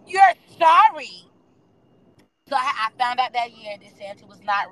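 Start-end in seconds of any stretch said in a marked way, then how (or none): 0.1-1.2 high, loud disbelieving retort; incredulous echo. Retold or imitated. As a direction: throw her apology back at her, outraged. Mom caught eating Santa's cookies.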